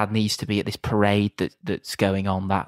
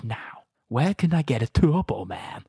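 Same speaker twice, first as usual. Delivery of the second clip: menacing Arnie; 5 semitones higher, slower than usual. Retold or imitated. Arnie grabbing kids, menacing.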